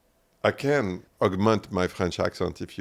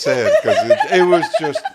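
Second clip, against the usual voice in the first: squealing laugh; 14 dB louder than usual. Real, not acted.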